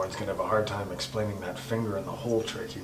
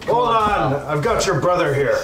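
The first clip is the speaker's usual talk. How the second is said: loud, high call to stop; shouting; 7 semitones higher, 8 dB louder than usual. Acted. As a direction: shout to break up a fight. Breaking up fight.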